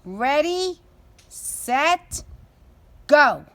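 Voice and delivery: loud race-starter call, each word punched; calling the race to begin. Acted. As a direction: shout the start like a race official. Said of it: "Magpie called".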